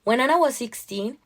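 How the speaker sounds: plain reading voice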